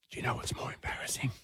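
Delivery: soft whisper close to her ear. Retold or imitated. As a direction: hush it so only she hears. Mum quietly talking to daughter on knee.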